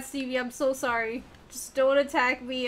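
usual speaking voice

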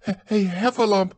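high, stammering between gasps; breathless Piglet. A narrator's reading. Piglet tells Christopher Robin the news, panting and high. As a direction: pant and struggle to get the word out. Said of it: "breathing so hard that he could hardly speak".

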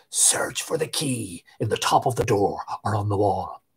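low, soft hollow voice from the dead; hollow corpse voice. A narrator's reading. Corpse speaking softly into Tig's ear, low and hollow. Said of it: "The voice said in his ear again".

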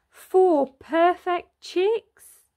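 slow, high and loud; delighted reveal. A narrator's reading. Narrator revealing the chicks to children, slow and delighted. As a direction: savour the happy surprise.

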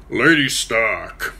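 a massive man's deliberate hail; Lord Nestor's greeting. Acted. Nestor greeting Lady Stark in the yard, slow and weighty. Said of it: "he said, bowing".

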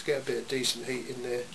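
ordinary talk

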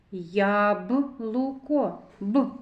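slow "Яблуко", then a lone "Б"; sounding out. Shown as a demonstration. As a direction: draw out "Яблуко" and isolate the sound "Б". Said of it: "зробимо звуковий аналіз слова яблуко".

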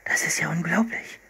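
voiceless whisper of wonder; hushed, astonished whisper; amazed whisper. A narrator's reading. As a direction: breathe it out in awe. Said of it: "flüsterte Philipp".

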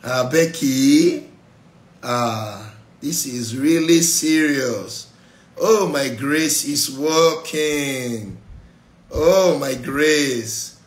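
awed mock marvel. God slowly marvelling, my grace is working. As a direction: savour every word in wonder.

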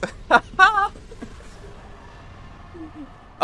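high breathless crying laugh; squealing laugh. Real, not acted. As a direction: laugh almost in tears. Boss finally beaten.

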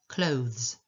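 "clothes" with full OH, then voiced th into z; clear th-z ending. Shown as a demonstration. Teacher showing a tricky word, "clothes", with careful th-z. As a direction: sound out the th and z in "clothes". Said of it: "To jest O, th, z".

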